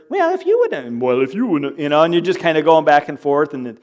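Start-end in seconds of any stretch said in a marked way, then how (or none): 0.1-1.0 high, nasal-free mock imitation of the spouse; mocking voice impersonation. Shown as a demonstration. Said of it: "the voice imper".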